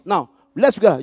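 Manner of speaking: usual speaking voice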